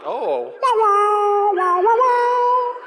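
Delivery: high, loud slow wah wah; mock battle cry. Boy armed with the pee gun, mock war cry. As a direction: ham up a silly war cry. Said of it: "and now I'm like".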